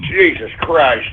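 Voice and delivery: normal talking voice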